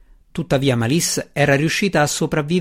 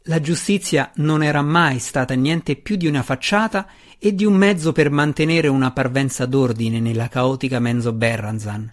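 ordinary delivery, nothing marked

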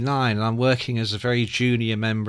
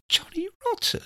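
incredulous; 6 dB quieter than usual. Retold or imitated. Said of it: "I'm thinking".

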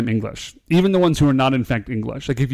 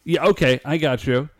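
normal talking voice

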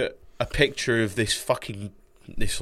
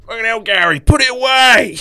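mock outrage; 12 semitones higher, 13 dB louder than usual. Real, not acted. Mock scolding dad's shirtless photo.

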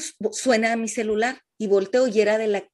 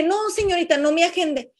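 high excited plea; 6 semitones higher than usual. Retold or imitated. To the clinic receptionist, high eager refusal. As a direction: pitch up, urgent refusal to the receptionist. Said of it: "Le dije".

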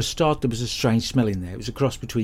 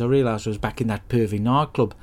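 ordinary talk

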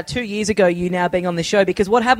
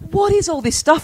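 high-pitched incredulous question; mock astonishment, pitched well up; baffled neighbour; 9 semitones higher than usual. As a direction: imitate a bewildered neighbour coming home. Neighbours baffled by film clutter.

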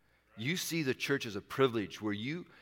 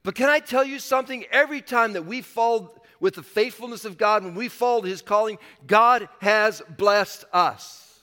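louder, higher, building sermon voice; full-force preaching; 6 semitones higher, 5 dB louder than usual. Acted. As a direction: drive the point home to the congregation. Preaches with rising intensity.

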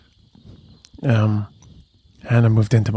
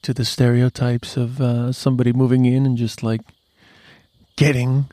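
ordinary talk, nothing marked

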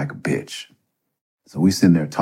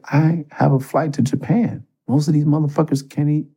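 higher, agitated self-scolding; mildly raised frustrated rant; frustrated inner voice; 10 semitones higher than usual. Retold or imitated. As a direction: berate yourself in a panic. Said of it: "I was like".

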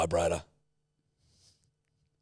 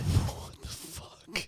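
slow whispered disbelief; stunned whisper; 9 semitones higher, 4 dB quieter, much slower than usual. Real, not acted. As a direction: breathe it out in shock. Reacting to weird voice message.

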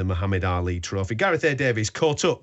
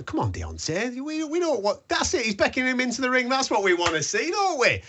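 much higher, eager calling out; excited urging; 13 semitones higher than usual. Real, not acted. Commentating live as Wilder is called into the ring, excited. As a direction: egg him on into the ring.

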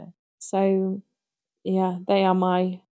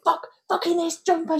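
imitated swearing mum; 10 semitones higher than usual. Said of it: "so swearing about it".

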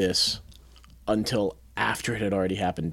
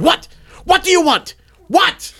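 high-pitched, loud angry demand; angry yelling; 9 dB louder than usual. Retold or imitated. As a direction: re-enact a short-fused outburst. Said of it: "I'm angry. I'm a very easily angered individual".

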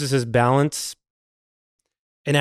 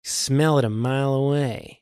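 slow, drawn-out star impression; Jack Nicholson; 4 dB quieter than usual. Boss answering a tipsy colleague, slow Nicholson-style impression. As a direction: stretch it out in a movie-star drawl. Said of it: "it is a Jack Nicholson accent".

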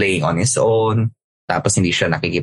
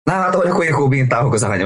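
ordinary talk, nothing marked

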